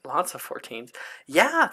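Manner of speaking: ordinary talk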